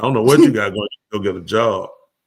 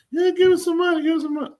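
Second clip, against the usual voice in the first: mock beggar plea; faster than usual.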